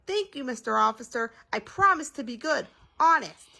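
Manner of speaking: rising, high-pitched assurance; lifted voice, loud final word; earnest promise. A narrator's reading. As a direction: promise eagerly like a child let off. Daddy promises the officer, childlike.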